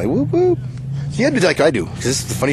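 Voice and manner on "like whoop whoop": squeaky high falsetto whoops; demonstrating a goofy noise for the camera, high; high whoop sounds. Shown as a demonstration. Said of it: "Hey, [name], do some shit like that".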